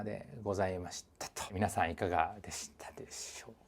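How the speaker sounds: soft unvoiced whisper to viewers. Real, not acted. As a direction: breathe the question like a secret. Whispered sign-off question.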